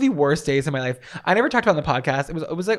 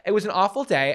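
ordinary talk

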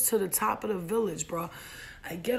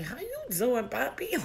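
flirty greeting; 5 semitones higher, slower than usual. Acted. Retells flirting with handsome man.

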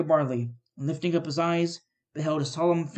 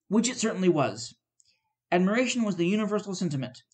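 usual speaking voice

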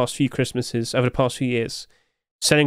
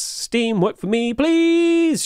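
pleading; 10 semitones higher, much slower than usual. Real, not acted. Begging the game to load.

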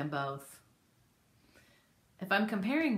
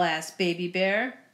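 ordinary delivery, nothing marked

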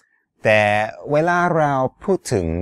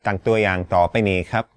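ordinary talk

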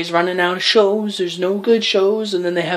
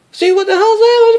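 incredulous outburst; 8 dB louder than usual. Real, not acted. Incredulous outburst ending rant.

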